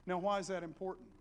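ordinary talk, nothing marked

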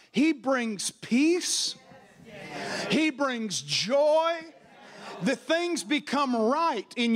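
slow, high preaching, each phrase stretched; rising sermon climax. Acted. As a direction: preach it out, drawing every word long.

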